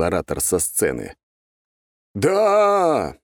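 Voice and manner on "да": loud, high shout of agreement; ragged crowd shout. A narrator's reading. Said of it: "нестройным хором отозвались люди". (нестройный хоровой выкрик)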